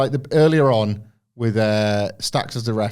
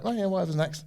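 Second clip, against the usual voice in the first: casual shrug off; 7 semitones higher, 7 dB quieter, faster than usual. Retold or imitated. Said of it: "then she just sort of like".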